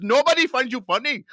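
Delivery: high, dismissive imitation Indian accent; mock Indian accent. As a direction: imitate an Indian clerk shooting him down. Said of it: "they had these Indian guys".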